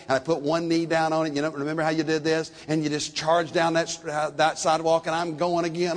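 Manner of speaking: usual speaking voice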